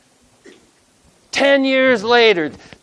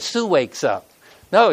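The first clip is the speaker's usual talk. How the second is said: ordinary delivery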